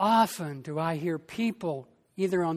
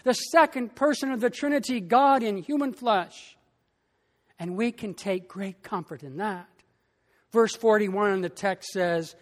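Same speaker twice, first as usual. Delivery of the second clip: normal talking voice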